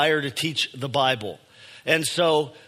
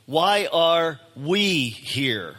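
very slow, with "we" held long and set apart; stress on WE; much slower than usual. Shown as a demonstration. Preacher asking the church its shared purpose, slow stress on "we". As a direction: spell it out word by word, leaning on "we". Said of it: "I wanted to emphasize the word".

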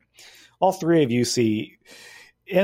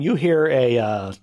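usual speaking voice

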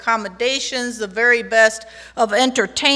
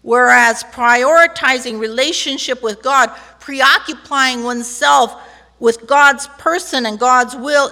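usual speaking voice

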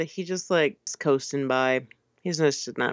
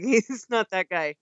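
ordinary talk

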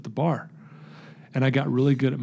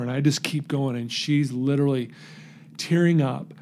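ordinary delivery

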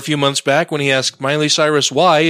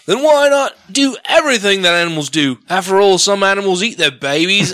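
higher-pitched, attempted Australian accent that lands British; bad Australian accent; 6 semitones higher than usual. Reading Ham's blog aloud, in a bad Australian accent. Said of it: "I can't do Australian".

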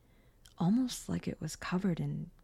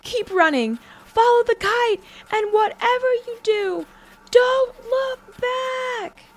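urgent, high full-voiced scream; 12 dB louder, slower than usual. A narrator's reading. Girl urging her brother to flee, screaming. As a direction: scream orders over the chase, drawing out the end. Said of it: "Janet screamed at the back".

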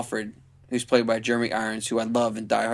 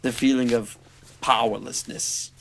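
lightly voiced slow Alfred; a hint of a grave butler; Alfred impression; slower than usual. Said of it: "Jeremy Irons as Batman in that voice".

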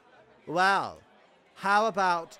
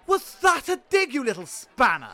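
mock offended gasp; 6 semitones higher than usual. Offended gasp at the king's jab.

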